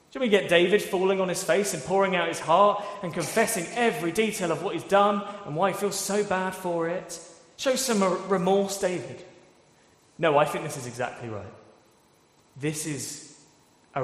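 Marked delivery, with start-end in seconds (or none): 0.1-9.2 louder, higher, challenging David to grovel; mock demand. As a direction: push it like an impatient critic. Mock demand for more remorse.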